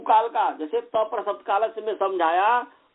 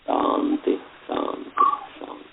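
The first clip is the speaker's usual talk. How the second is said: whispered shanti. Acted. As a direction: let each shanti fade in a whisper. Whispered close of mantra.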